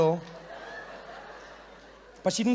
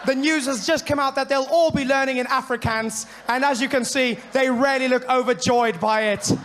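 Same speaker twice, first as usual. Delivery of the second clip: foreign reporter; 5 semitones higher, 5 dB louder than usual. Retold or imitated. Foreign correspondent parody.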